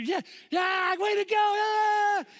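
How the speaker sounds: high, loud drawn-out cheer; back to cheering. Retold or imitated. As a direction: yell wild encouragement in imitation of her. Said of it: "she just went back to cheer".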